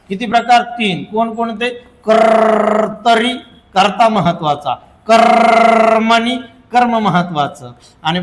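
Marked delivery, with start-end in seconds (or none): 2.1-3.3 drawn-out term. Shown as a demonstration. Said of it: "teacher stretches term". 5.1-6.4 drawn-out term. Shown as a demonstration. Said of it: "teacher stretches term".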